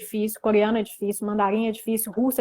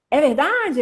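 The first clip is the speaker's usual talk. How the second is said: surprised question; 6 semitones higher, 5 dB louder, slower than usual. Shown as a demonstration. Acting an example surprised exchange.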